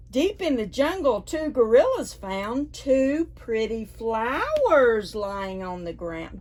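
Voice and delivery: ordinary delivery, nothing marked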